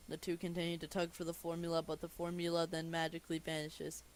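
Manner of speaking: ordinary talk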